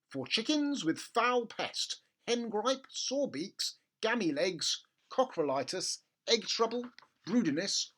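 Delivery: high, slow official label voice; label read. Acted. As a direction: read it out like a pompous notice. Reading the label aloud.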